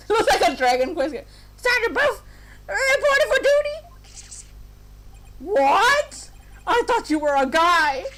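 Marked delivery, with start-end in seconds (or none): 1.6-3.8 put-on high, eager little girl soldier; tiny girl voice. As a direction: chirp it like a tiny cadet. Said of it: "She's a tiny girl".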